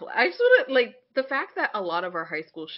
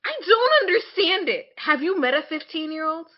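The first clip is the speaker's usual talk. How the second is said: louder and higher, fed up; incredulous outcry at shows; exasperated outburst; 7 semitones higher, 5 dB louder than usual. Real, not acted. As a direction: throw up your hands in disbelief. Exasperated rant.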